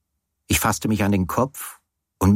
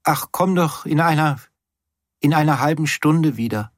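high, drowsy slurred voice; half slurred, sleepy; 7 semitones higher than usual. A narrator's reading. Sleepy Teddy sends the narrator away, half slurred. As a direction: mumble it still half asleep. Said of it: "Es klang immer noch halb gelallt".

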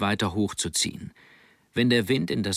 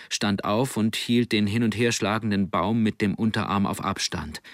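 plain reading voice

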